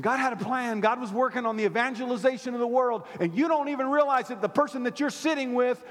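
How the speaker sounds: high and loud preaching; rising fervent preaching; preaching at full force. Acted. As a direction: lift the room, full preach. Preaching peak.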